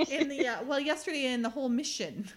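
ordinary delivery

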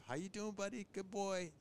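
a quick cheerful hello to your dog; greeting the dog. Retold or imitated. Calm greeting to his dog.

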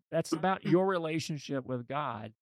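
normal talking voice

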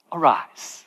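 low, soft single word; simple, quiet command. Acted. Jesus speaking to the dead girl, simple quiet command. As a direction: say it simply and softly. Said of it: "he uses these simple words".

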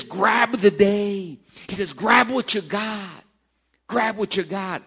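slow, loud, each word pressed; slow emphatic preaching; emphatic quote. Retold or imitated. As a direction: drive the words home like a command.

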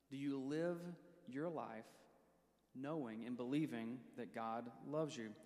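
ordinary delivery

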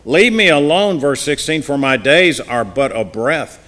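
impassioned Bible reading; forceful scripture reading. Acted. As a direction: read it loud and pleading, preacher style.

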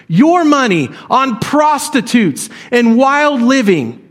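slow, resentful, building to an indignant question; scornful slow outrage. Acted. Older son scorning the feast for his brother, slow and indignant. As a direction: drag the charge out slowly against the father. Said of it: "can you see this picture?".

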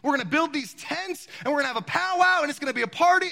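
a hint of an excited Peter pitching his party plan; eager Peter. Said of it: "in the middle of saying".

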